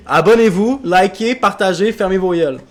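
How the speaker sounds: loud, high hyped sign-off; shouted outro. Pretend. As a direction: bark the outro at the viewers.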